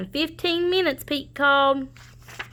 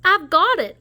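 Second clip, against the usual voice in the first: raised excited voice; excited idea; 8 semitones higher, 5 dB louder than usual. Acted. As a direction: eureka moment. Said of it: "Ollie said".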